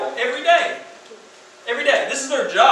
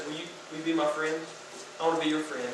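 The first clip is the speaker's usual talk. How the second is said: softer, lower gentle plea; soft tender ask; 5 semitones lower, 9 dB quieter, faster than usual. Acted. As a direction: ask quietly, like offering friendship. Jesus asking sinners.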